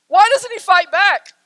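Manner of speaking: high, loud protest; anguished cry. Acted. Sisters watching Aslan be bound, anguished cry. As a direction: cry it out to be heard. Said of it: "they begin to cry to themselves".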